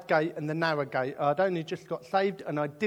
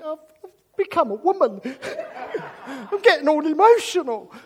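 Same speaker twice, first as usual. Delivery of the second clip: laughing; 8 semitones higher, 8 dB louder than usual. Real, not acted. Laughing self-mockery.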